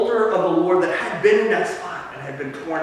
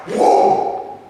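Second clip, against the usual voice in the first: awed whoa; 4 dB louder than usual. Acted. Fire falls from heaven.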